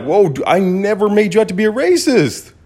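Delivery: high, rising exasperated denial; retold protest. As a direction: push back harder in disbelief. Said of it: "And I'm like".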